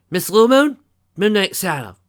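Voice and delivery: high, loud military address; saluting guard. A narrator's reading. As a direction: call out like a guard reporting for duty. Bat pony guard reports, saluting.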